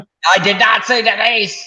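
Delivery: high, loud, sharp protest; indignant denial. Real, not acted. Protesting to friends that he never said Denise, loud and high. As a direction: fire back a denial, hot and high. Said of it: "You sound exactly like my mother does when she's imitating people that are angry".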